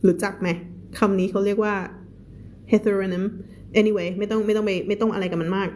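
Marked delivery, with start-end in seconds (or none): none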